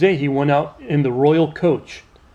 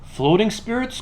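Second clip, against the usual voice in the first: high-pitched questioning exclamation; astonished cry; 7 semitones higher than usual. A narrator's reading. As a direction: cry out in wonder. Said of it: "cried Prince John".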